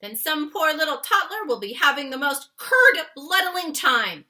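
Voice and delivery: loud, vehement warning; loud vehement giant's warning; fierce. Acted. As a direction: press the danger hard. Giant warns of nightmare.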